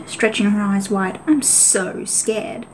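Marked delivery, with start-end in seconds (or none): 1.3-2.7 very slow, drawn-out sarcastic fright; mock fear. A narrator's reading. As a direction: drag it out to mock them. Rogue mocks the clan cats.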